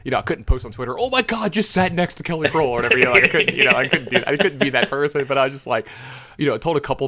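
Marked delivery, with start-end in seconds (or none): 1.0-2.7 high-pitched imitation of a gushing fan; mock starstruck fan. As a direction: squeal it like an overexcited social media post. Mimics fan post he couldn't make.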